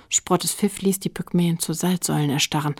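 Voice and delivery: plain reading voice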